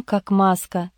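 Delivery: plain reading voice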